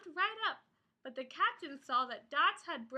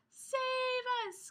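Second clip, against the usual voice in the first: higher pleading call; crying for help; 8 semitones higher than usual. Acted. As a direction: plead to be rescued. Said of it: "cried the pirates".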